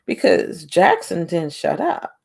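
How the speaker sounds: normal talking voice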